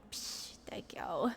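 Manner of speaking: breathy whispered thanks. Real, not acted. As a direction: whisper it shyly. Brushing off a compliment.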